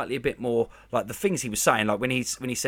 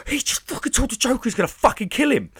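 much higher, thrilled; excited; 12 semitones higher than usual. Real, not acted. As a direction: blurt it out, giddy with excitement. Thrilled by Batman's line.

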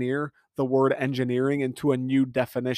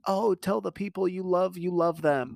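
higher-pitched parody of a sentimental post; mock sappy; 6 semitones higher than usual. Mimicking Twitter posts about loving people, mock sappy. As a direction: put on a syrupy, high online-platitude voice.

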